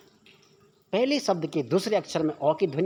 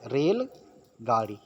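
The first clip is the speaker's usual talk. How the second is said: slow emphasis; 6 semitones lower, much slower than usual. Shown as a demonstration. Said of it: "तो रेलगाड़ी क्या है?".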